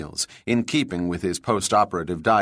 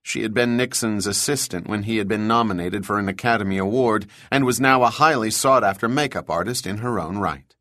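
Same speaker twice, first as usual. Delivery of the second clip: ordinary delivery, nothing marked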